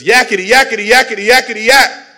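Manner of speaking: high, loud toy-monkey chatter. Acted. Monkey interrupting Jesus, noisy chattering voice. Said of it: "This monkey showed up and says".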